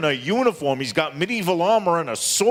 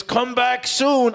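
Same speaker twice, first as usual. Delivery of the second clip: lightly voiced old guard, drawn out and higher; slow, raised-pitch quote of an old guard; old security guard; 7 semitones higher, much slower than usual. Old guard's line in a bit.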